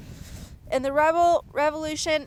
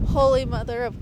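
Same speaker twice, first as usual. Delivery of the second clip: ordinary talk, nothing marked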